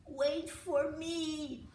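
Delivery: long drawn-out call, slow; howled. Acted. Little brother left behind howls after brothers, slow stretched call. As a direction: stretch it out like a howl. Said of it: "howled Uli".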